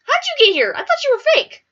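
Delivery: hammed-up high shocked squeak; mock shock. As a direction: gasp it in wide-eyed disbelief. Shocked to meet Slender Man.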